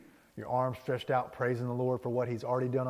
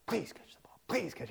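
high, hushed pleading under the breath; imitating a nervous boy praying for luck; desperate plea; 6 semitones higher, 6 dB quieter than usual. Smalls waits with glove up, desperately pleading.

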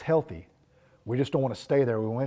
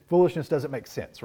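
usual speaking voice

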